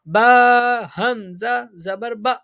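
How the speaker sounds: slow chanted spelling, final "با" snapped off with a glottal catch; jerk on hamza. Shown as a demonstration. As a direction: hold the letters long, then cut "با" short with a jerk. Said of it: "حمزہ ساکن کو ہمیشہ جھٹکا دے کے پڑھتے ہیں". (ہمزہ ساکن پر جھٹکے سے "با")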